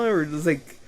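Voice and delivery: ordinary talk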